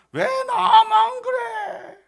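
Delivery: very high, loud, drawn-out slow wail of complaint; mock angry whine. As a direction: wail it like a spoiled grown man. Mocking Naaman's complaint.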